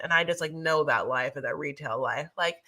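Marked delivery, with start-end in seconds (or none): none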